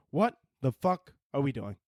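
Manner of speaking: ordinary talk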